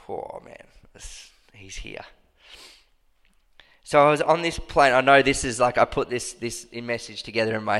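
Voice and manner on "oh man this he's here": voiceless whisper of wonder; hushed awe. Real, not acted. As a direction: breathe it, awed by presence. Sensing presence, hushed.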